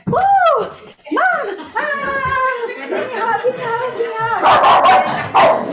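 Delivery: high, squealing burst of joy; imitating a daughter who flips to overjoyed, hamming it up; gushing excited daughter. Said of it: "she's like".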